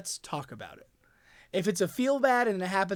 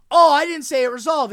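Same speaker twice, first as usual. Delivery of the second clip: retold heated argument; 7 semitones higher, 9 dB louder than usual. Retold argument between two players.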